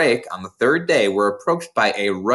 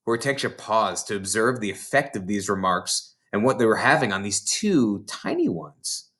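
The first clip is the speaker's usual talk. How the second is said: ordinary delivery, nothing marked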